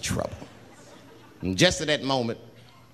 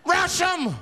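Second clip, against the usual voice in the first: high-pitched acted yell; screamed battle cry; 13 semitones higher, 3 dB louder than usual. Snapped passenger rallying the bus, screamed battle cry. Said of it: "He screamed out".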